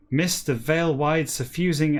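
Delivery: ordinary delivery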